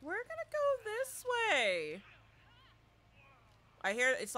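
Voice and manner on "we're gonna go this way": sing-song. Real, not acted.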